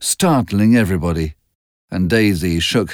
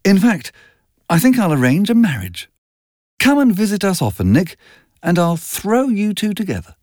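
higher, playful matchmaking voice; teasing Daisy; 10 semitones higher than usual. A narrator's reading. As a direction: tease him lightly and brightly.

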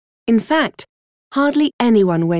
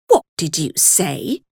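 plain reading voice

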